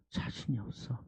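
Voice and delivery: low hushed whisper. Real, not acted. Pastor on Peter's doubt, soft whisper. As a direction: say it barely audible.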